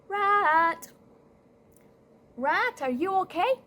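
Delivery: high, loud searching call. Acted. Cat checking on Rat at home, calling out. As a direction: call through the house for a friend.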